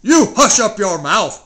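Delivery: loud, furious shout; howled order. Acted. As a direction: shout Mac down. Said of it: "howled the mighty King Yertle".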